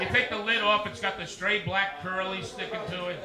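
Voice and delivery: normal talking voice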